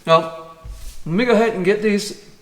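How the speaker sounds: talking through a bite of food; chewing while talking. Real, not acted. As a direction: mouth full. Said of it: "I shouldn't talk while I'm eating".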